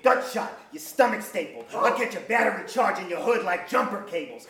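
normal talking voice

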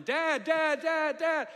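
loud, high-pitched repeated calls; yelling for Dad. Retold or imitated. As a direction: yell out like a lost child calling for a father. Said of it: "I started yelling".

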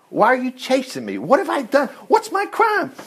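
rising, high-pitched indignant questions; pleading protest. Acted. David asking Saul why he is hunted, pleading in protest.

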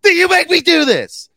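loud, exasperated yelling; frustrated outburst. Real, not acted. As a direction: blow up in frustration.

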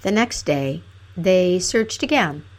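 usual speaking voice

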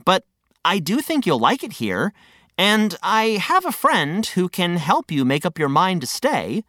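ordinary delivery

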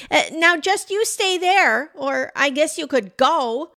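high-pitched, hesitant call; wary calling out. A narrator's reading. Pam warns off a stranger through snow, wary call. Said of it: "She called out again".

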